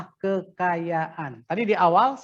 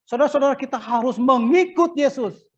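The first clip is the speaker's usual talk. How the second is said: raised, louder sermon call; preacher's exhortation; 6 semitones higher, 5 dB louder than usual. Shown as a demonstration. Preacher showing the Indonesian wording to his class, raised exhortation. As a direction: exhort the congregation, pitched up. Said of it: "Indonesianya begitu".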